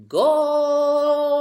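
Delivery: high, loud triumphant goal cheer; a big celebratory burst on goals; excited cheer. A narrator's reading. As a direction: shout it like she just scored. Magical cinta scoring goals.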